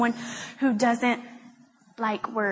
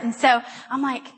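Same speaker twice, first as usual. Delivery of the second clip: ordinary talk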